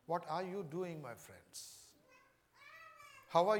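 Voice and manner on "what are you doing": ordinary talk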